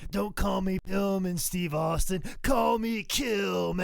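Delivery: loud, high hammed-up promo voice; mock wrestler promo. Imitating Steve Austin to the co-hosts, loud hammed-up promo. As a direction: cut a growling wrestling promo.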